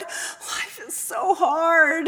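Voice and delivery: very slow, trailing lament; fading sob. Acted. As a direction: let the sob die away. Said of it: "he groaned".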